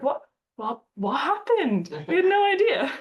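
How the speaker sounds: a team playing dumb to a sympathetic country, mock surprise. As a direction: play innocent, hammed-up. Feigning ignorance to country B.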